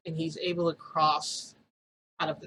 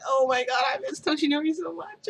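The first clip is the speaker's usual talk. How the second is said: swooning gush; 9 semitones higher, 3 dB louder than usual. Retold or imitated. After a passionate kiss.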